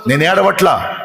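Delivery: fervent sermon build, voicing Mary's answer; preaching at full force. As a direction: hammer each line home like a pulpit refrain.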